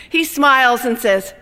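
plain reading voice

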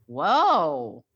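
usual speaking voice

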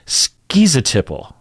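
"schizotypal" as SKEE-zuh-tip-ul; schizotypal with ski. Shown as a demonstration. Teacher demonstrating the ski version of "schizotypal". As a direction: open "schizotypal" with a long skee. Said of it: "pronounce it ski, as in".

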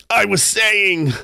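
very high, drawn-out character voice; chuckling sailor's voice. A narrator's reading. Tarpaulin ignores the excitement, high sailor's voice. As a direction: pitch it high and stretch it out.

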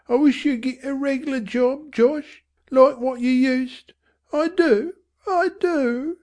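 high, whimpering mother; tearful mother. A narrator's reading. Mother begging husband to stop, tearful. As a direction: plead through tears.

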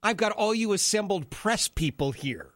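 mock grand host voice; mock Trump. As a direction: play the showman inviting the press to chat. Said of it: "it's like".

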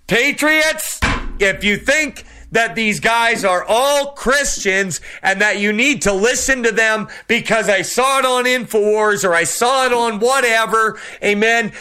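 high-pitched preacher's call to the patriots; fiery preaching. Acted. Preaching at full force.

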